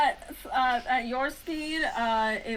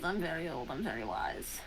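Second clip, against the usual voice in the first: fading lower and softer; sinking low; 7 semitones lower, 8 dB quieter than usual. Acted. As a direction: drop deep and quiet, ancient. Dryad repeats, voice sinking away.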